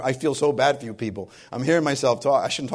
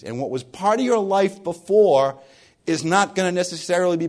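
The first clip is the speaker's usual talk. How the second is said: raised, driving sermon delivery; passionate preaching; 4 semitones higher, 3 dB louder than usual. Acted. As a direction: preach it with rising fervor. Preaching builds to full force.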